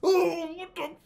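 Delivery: high wailed cry, as if underwater; imitated underwater cry. Dreamer going under as the jet explodes, muffled-style wail. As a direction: wail it like you are submerged. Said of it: "under the fucking water going like".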